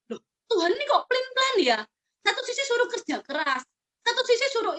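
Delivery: high, surprised puzzled question; mock puzzlement. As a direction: act baffled at God's contradictions. (heran pura-pura, nada tinggi)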